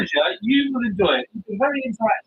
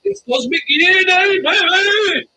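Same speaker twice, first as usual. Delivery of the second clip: excited shout; 9 semitones higher, 7 dB louder than usual. Real, not acted. Cheering a horse on stream.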